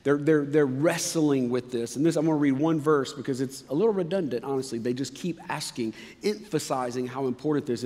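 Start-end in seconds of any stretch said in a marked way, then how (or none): none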